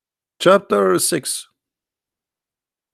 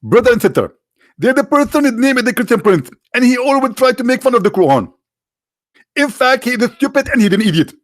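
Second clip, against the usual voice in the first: mock preacher voice, pitched much higher and louder; Zakir Naik impression; 8 semitones higher, 3 dB louder than usual. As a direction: lecture the crowd like a confident televangelist debater. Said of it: "He will say to you".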